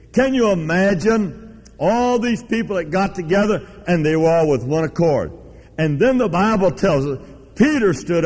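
high, emphatic pulpit proclamation; fervent preaching. Real, not acted. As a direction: proclaim it with force, stretching the key words. Preacher dramatizes the Bible story.

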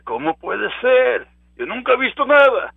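mock the skeptic who has never seen anything; doubting listener. Preacher voicing a doubter in the crowd, incredulous and loud.

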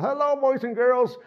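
high, squeaky dummy voice through still lips; cheery high puppet greeting; ventriloquist dummy voice. Retold or imitated. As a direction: talk like a puppet without moving your mouth. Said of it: "'cause he wouldn't move his mouth".

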